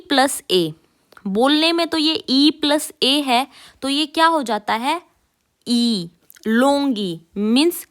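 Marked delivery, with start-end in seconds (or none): 5.7-7.2 a long, slow "ई". Shown as a demonstration. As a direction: hold "ई" out long. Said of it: "long ई". (लंबा खींचा हुआ "ई")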